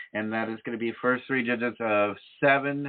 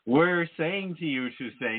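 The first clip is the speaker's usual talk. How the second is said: ordinary talk, nothing marked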